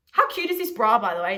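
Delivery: suddenly delighted by her own bra; a bright little squeal of delight; excited aside. Real, not acted.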